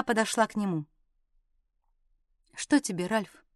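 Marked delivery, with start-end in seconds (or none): 2.5-3.4 soft, low, reluctant voice; hushed and submissive; quiet question. A narrator's reading. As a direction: ask softly, unwillingly. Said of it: "тихо спросила она".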